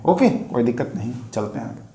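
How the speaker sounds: usual speaking voice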